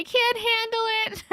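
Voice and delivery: high, giggling I can't handle it; real giggle, voice up high; laughing. Real, not acted. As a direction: crack up through the words. Laughing at Comic Sans.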